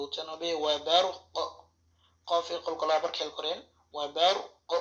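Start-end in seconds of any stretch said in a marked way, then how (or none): 0.6-1.4 ক bounced with an echoing release in ওয়া বার ক; ওয়া বার ক with the ক snapped and rising; qalqala demo. Shown as a demonstration. Teacher demonstrating qalqala on ক to students. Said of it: "কফে কলকলা করতে হবে". 3.9-4.7 ক bounced with an echoing release in ওয়া বার ক; ওয়া বার ক with the ক snapped and rising; qalqala demo. Shown as a demonstration. Teacher demonstrating qalqala on ক to students. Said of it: "এভাবে কফটা একটু উপরের দিকে উঠবে".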